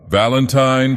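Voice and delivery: called out name. A narrator's reading. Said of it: "cried the girl".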